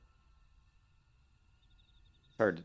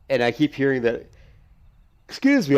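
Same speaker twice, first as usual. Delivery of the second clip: yawning; 6 semitones higher, 14 dB louder than usual. Real, not acted. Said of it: "I'm yawning".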